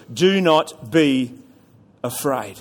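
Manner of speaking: slow, hushed emphasis; slow emphatic reading. Shown as a demonstration. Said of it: "Those first four words".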